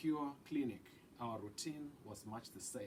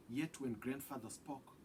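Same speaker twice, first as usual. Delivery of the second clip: plain reading voice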